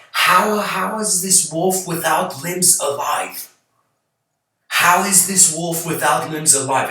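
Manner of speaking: man stunned by a limbless wolf, slow shocked cry; shocked disbelief. Retold or imitated. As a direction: gasp out the question in shock, half-acted. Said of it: "he gets shocked".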